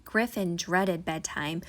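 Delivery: plain reading voice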